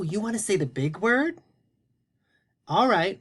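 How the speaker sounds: normal talking voice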